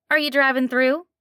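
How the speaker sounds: a friendly diner waitress with a slight twang; a hint of a nasal twang. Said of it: "slight twang to it".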